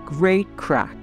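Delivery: normal talking voice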